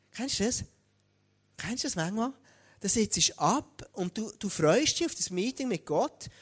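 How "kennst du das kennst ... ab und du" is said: ordinary delivery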